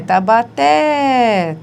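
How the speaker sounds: Tabaté stretched very slowly, ending on TÉ; stressed syllable shown. Shown as a demonstration. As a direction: land hard on the last syllable of Tabaté. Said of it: "tá aí uma oxítona". (Tabaté devagar, forte no TÉ)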